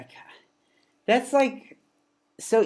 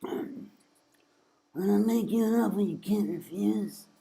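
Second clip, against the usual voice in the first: soft, slow hoarse mob boss; soft slow Godfather voice; Godfather impression; 6 semitones higher, 5 dB quieter, slower than usual. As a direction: murmur it like a Mafia don. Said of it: "The Godfather".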